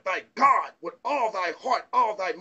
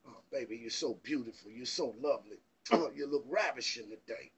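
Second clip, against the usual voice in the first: much lower and softer, a crooning lover's murmur; sweet-talking man; 10 semitones lower, 11 dB quieter than usual. Retold or imitated. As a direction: croon it low like you're wooing her.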